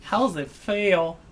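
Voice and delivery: playful drawn-out repeat; mock echo. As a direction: echo it back in a silly voice. Echoing in mock voice.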